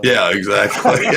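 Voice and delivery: laughing. Real, not acted. Laughing at joke.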